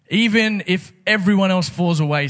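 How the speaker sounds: loud, high insistence; bold protest. Retold or imitated. Peter vowing loyalty to Jesus, loud and high. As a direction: proclaim it like brash Peter.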